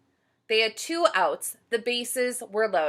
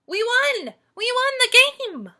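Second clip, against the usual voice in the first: very high, loud cheer; Tom yelling; 9 dB louder than usual. Acted. Tom cheering the win to his team, loud excited yell. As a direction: whoop the victory. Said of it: "Then Tom yelled".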